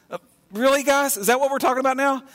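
can you believe this, quick and high; incredulous. Real, not acted. Preacher to congregation, mocking the disciples' petty question in disbelief.

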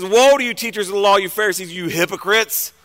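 ordinary talk

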